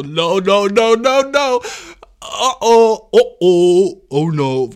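loud, rising alarmed no-no-no; hammed-up panic, climbing then dropping back; frantic backpedalling, played big; mock panic. Acts panic at her accusation.

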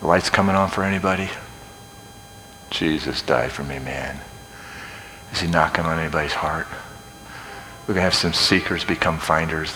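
hushed, reflective preaching with long pauses; soft altar appeal. Real, not acted. Preacher inviting seekers to Jesus, softly and reverently. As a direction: draw listeners in quietly.

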